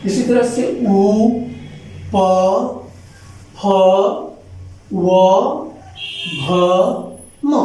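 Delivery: ऊ, प, फ, व, भ, म sounded one by one, each made with the lips; teacher listing the lip letters aloud; lip sounds demo. Shown as a demonstration. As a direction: say ऊ प फ व भ म slowly, one at a time. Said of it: "बच्चों".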